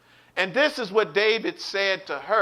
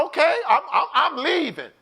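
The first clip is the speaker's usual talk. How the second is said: high-pitched, stammered giving in; retold flustered wife; 8 semitones higher than usual. Wife answering husband who ordered her out, flustered compliance. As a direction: hurriedly concede in a raised voice.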